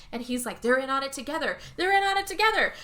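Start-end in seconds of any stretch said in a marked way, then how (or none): 1.8-2.7 higher and louder insistent repeat; husband convinced of a plot, repeat louder; building paranoia. Retold or imitated. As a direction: say it again more frantically.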